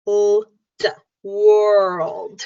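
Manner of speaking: world with the l and d sounded out, slow; careful world. Shown as a demonstration. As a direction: spell the sound out, then say world. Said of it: "And for world, it's u".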